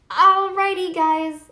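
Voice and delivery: bouncy goodbye; cheery sign-off. Real, not acted. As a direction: sing it out to viewers.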